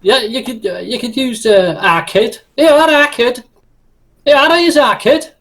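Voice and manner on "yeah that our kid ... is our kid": higher, hammed-up Scouse. Shown as a demonstration. Offering a Scouse name for the character, broad Scouse. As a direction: show off the nickname in broad Scouse. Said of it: "insulting Scouse name".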